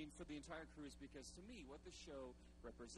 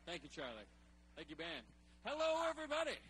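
loud hype host; podium speech; 3 semitones higher, 7 dB louder than usual. Real, not acted. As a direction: fire up the crowd from the stage. Hyping the crowd from stage.

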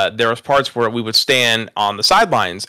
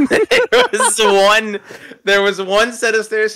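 laughing; 10 semitones higher, 4 dB louder, slower than usual. Real, not acted. Laughing while telling story.

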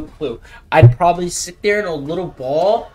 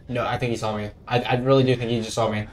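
ordinary talk, nothing marked

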